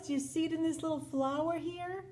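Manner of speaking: usual speaking voice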